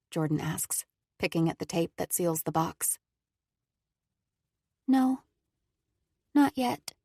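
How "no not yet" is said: touch of sadness. Acted. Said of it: "a twinge of sadness in her words".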